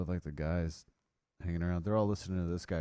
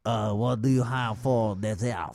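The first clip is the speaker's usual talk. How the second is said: put-on French accent; 5 dB louder than usual. Mocking French diners.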